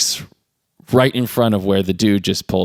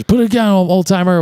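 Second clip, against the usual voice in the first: high, raised-voice command; mock robber's order; 11 semitones higher, 4 dB louder than usual. Robber to the old security guard, mock loud demand. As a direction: imitate a gunman calling out a demand.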